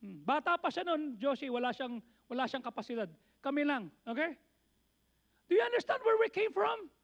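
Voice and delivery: ordinary talk, nothing marked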